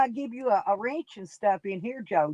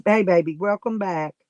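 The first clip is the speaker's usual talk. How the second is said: ordinary talk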